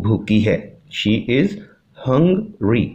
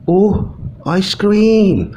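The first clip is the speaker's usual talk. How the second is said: put-on high, loud little girl's oh at the ice cream; a child spotting ice cream, wide-eyed and longing; high, slow wonder of a hungry little girl; girl's delighted gasp; 10 semitones higher, 4 dB louder, slower than usual. Poor girl sees ice cream.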